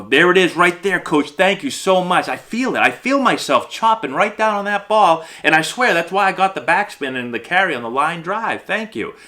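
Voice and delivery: high-pitched, over-eager mock enthusiasm; gushing player. Player convincing coach he chopped down, gushing with excitement. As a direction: thank the coach as if it's a revelation. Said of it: "you're really trying".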